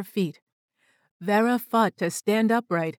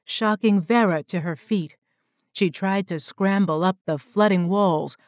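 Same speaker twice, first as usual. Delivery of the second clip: ordinary delivery, nothing marked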